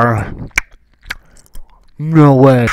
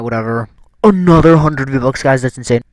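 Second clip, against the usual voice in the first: ordinary talk, nothing marked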